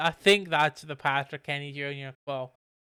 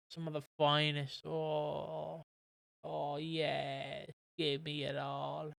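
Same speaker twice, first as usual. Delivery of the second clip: slow, hushed dreamy sighing over her hair; mock blissful swoon; 4 dB quieter, slower than usual. Boyfriend sniffing his date's hair, mock blissful and hushed. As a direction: act out a lovestruck man savouring her hair.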